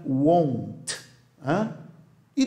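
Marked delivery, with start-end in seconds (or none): 0.0-1.0 slow model. Shown as a demonstration. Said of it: "é mais fluente".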